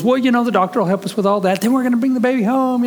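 high, hurried brush-off from a mother; Heather. Retold or imitated. Mother telling child about the doctor, evasive.

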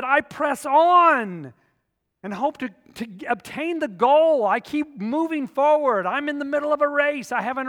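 preaching at full force. Acted. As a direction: preach it out to the whole room.

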